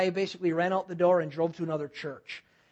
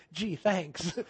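deadpan mock thanks; dry sarcasm; 2 semitones lower, 4 dB quieter than usual. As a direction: say it as wry, unimpressed gratitude. Mock gratitude for unwanted job.

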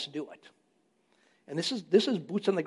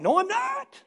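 high-pitched mock yell of denial; 4 dB louder, slower than usual. Husband denying to wife that he yells, yelling. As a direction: deny the yelling in a raised, high voice. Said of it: "You're yelling".

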